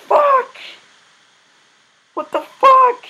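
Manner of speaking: high-pitched frustrated outburst; exasperated swearing. Real, not acted. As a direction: swear in disbelief at the game. Swearing at the game.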